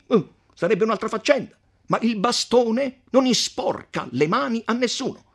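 the count scorning the messenger as a scoundrel; arrogant count. Acted. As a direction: sneer at the mayor's scruples. Count Attilio argues on.

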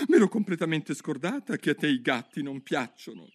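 put-on a curious girl talking to herself; Alice thinking aloud. Alice muses alone in the lake, light girl voice.

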